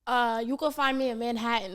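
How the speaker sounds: put-on joking New York accent; a hint of New York accent. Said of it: "let me not get my New York accent".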